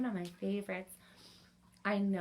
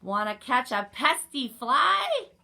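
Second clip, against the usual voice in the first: loud, higher storybook question; rooster character; 3 semitones higher, 12 dB louder, slower than usual. A narrator's reading. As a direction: call out the rooster's invitation. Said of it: "crowed the rooster".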